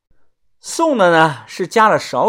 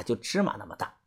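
usual speaking voice

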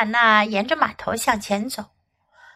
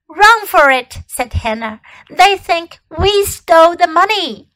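urgent; 5 semitones higher, 5 dB louder than usual. Acted. Children fleeing in alarm.

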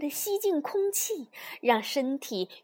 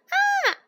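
short high-pitched shriek; little toad squeals in fright; frightened scream; 6 dB louder than usual. A narrator's reading. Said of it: "小蛤蟆尖叫着又逃走了".

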